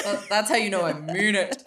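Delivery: loud, dipping into a baritone; mock-earnest baritone. Real, not acted. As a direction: drop into your baritone to show you mean it, amused. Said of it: "It brings out my baritone".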